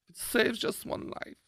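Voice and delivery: whispered mock slogan. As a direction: breathe it out like a secret. Mocking slogan repeated.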